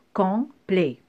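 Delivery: "complet" in two separated syllables; segmented, teacherly "complet". Shown as a demonstration. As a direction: break COMPLET in two with a pause. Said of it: "couper le mot par syllabe".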